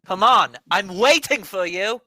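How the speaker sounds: shouted in-character challenge; battle taunt shout. Acted. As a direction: call the enemy out, loud and high.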